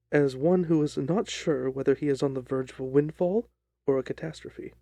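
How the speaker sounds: ordinary delivery, nothing marked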